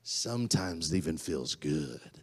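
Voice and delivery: ordinary delivery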